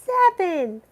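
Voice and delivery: high squeaky drawn seven; squeaky plea. Pretend. Begging stream viewers for likes, high squeaky plea. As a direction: squeak it like a pleading cartoon.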